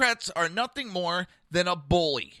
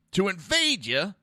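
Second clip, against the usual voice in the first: ordinary delivery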